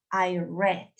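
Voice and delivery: "read" said like the color red; short e in "read", rhyming with bed; past-tense pronunciation. Shown as a demonstration. Teacher repeating the past example, past-tense pronunciation. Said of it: "se pronuncia read, como el color".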